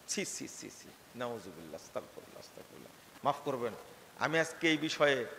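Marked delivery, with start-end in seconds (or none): 0.1-3.3 disgusted muttering. Acted. Recoiling from a shameful insult.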